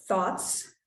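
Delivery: usual speaking voice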